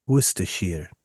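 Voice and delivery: "Worcestershire" said as "Wuss-tuh-sheer"; British pronunciation. Shown as a demonstration. Confirming it from UK friends, British pronunciation. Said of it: "it's pronounced".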